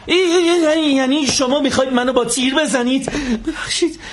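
terrified high-pitched stammer; panicked. Acted. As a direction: yelp in panic at the gun. Sees the gun, panics.

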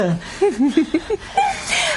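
very high, breathy nervous titter; strained high nervous laugh. Acted. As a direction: giggle to hide the fear. Nervous laugh about missing.

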